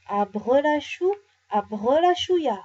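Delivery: slow, grand magic words; inspired incantation. A narrator's reading. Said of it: "d'un air très inspiré".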